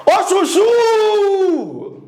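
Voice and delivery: high, very drawn-out hail; calling out. Shown as a demonstration. Teacher calling friend Chuchu across street, calling out. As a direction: holler "Chuchu" across the street, holding the last "chu". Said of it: "do outro lado da rua".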